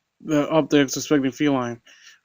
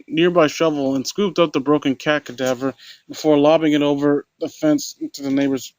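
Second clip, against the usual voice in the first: ordinary delivery, nothing marked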